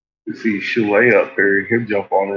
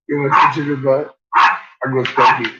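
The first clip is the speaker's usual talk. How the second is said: ordinary delivery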